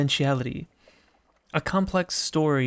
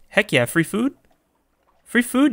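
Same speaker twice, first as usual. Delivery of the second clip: higher, louder, rising glee; eager excitement; 7 semitones higher, 6 dB louder than usual. Real, not acted. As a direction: jump at the offer with rising pitch. Excited at offer of free food.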